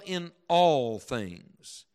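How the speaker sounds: "all" held long and weighty; slow right down and lean on "all"; lengthened, emphatic "all"; stress on ALL. Shown as a demonstration. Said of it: "I'll emphasize it as I read it".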